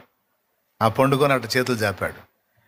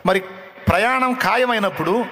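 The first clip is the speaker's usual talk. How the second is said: higher, insistent questioning; fool pressing the king; 7 semitones higher than usual. Acted. Fool presses the dying king on his destination, higher voice. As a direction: needle the king with a simple question.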